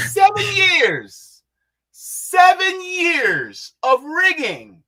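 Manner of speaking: slow, high, drawn-out yell; exasperated shout. Real, not acted. Host stressing how long the rigging has lasted, incredulous shouting. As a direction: hammer it out loud, stretching every word.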